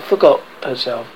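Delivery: plain reading voice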